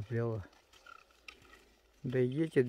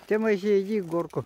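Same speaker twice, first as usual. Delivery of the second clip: raised voice calling to son; 8 semitones higher, 9 dB louder than usual. Real, not acted. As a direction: call him up the hill. Calls son to go uphill. (зовёт сына громко)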